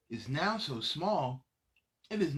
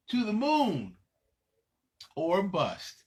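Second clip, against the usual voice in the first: slogan call; 2 semitones higher, 5 dB louder than usual. Acted. Their slogan.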